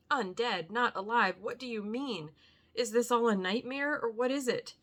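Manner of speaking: high, loud disbelieving questions; aghast. A narrator's reading. Arthur aghast at Van Helsing, high loud disbelief. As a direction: cry out in disbelief.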